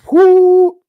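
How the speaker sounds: high, loud "whoo"; sudden piercing "whoo" of disbelief; loud whoop. Real, not acted. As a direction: let out a big high-pitched whoop. Exclaiming how wacko it is.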